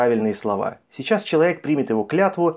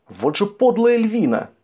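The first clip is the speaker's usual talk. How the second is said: indignant exclamation; 8 semitones higher, slower than usual. Acted. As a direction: spit out the insult, slowly. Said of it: "воскликнул эльф".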